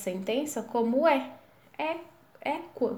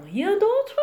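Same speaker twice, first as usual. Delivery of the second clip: rien d'autre with a high rising question tune; 10 semitones higher, 7 dB louder than usual. Shown as a demonstration. Waiter asking if anything else, rising question. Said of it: "A diferença vai ser a entonação".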